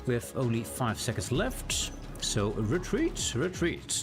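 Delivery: ordinary talk, nothing marked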